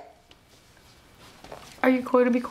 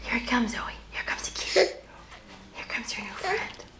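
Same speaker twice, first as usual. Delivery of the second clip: whispering; 7 dB quieter than usual. Real, not acted. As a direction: whisper it softly to the little one. Whispering to baby.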